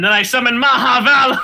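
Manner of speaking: dramatic summon. Pretend.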